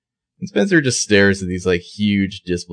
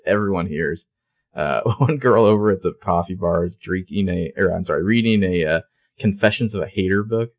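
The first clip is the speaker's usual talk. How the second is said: ordinary talk, nothing marked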